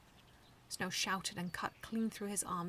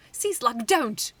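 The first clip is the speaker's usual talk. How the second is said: loud, higher panicked shriek; loud, high panicked cry; 4 semitones higher, 15 dB louder, much slower than usual. Acted. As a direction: shriek to stop him, too late. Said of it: "Shrimplip shrieked".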